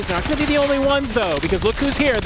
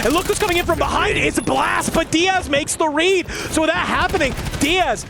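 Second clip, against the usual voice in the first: rising, faster fight commentary; building hype call; 7 semitones higher than usual. Real, not acted.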